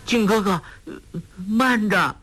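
high, halting frightened plea; scared, pitched well up; trembling with fear. A narrator's reading. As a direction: quaver out a hesitant call to wait. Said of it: "颤声说".

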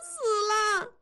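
put-on a girl howling and crying that her stomach hurts; wailing in pain. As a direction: cry out for mother, sobbing in pain. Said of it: "燕妮却猛地哭嚎起来， 喊道".

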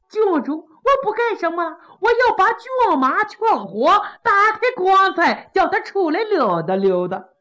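deadpan mischief; playful official. Acted.

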